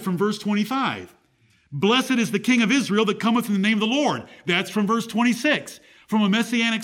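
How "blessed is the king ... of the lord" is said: scripture reading. Acted. Reads scripture aloud.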